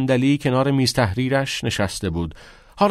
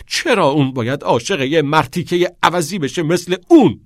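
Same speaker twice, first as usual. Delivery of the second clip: louder, higher frustrated outcry; shouting; 5 semitones higher, 5 dB louder than usual. A narrator's reading. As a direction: shout it at the unfairness. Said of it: "ناکس فریاد زد".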